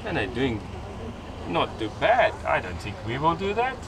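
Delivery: ordinary talk, nothing marked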